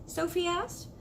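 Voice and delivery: ordinary delivery, nothing marked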